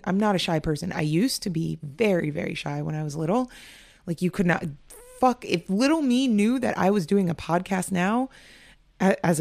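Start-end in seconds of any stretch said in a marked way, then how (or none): none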